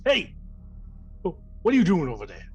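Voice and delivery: booming shout from across the room; dopey cartoon bellow. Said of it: "you hear a booming voice".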